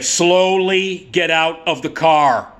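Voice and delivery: drawn-out, deliberate officer order; slow police command. Retold or imitated. Officer orders driver out of the car, slow and commanding. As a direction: stretch each word like a wary cop.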